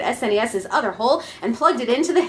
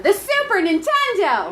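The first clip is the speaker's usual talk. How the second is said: excited reveal; 8 semitones higher than usual. Acted. Dramatic reveal of the console.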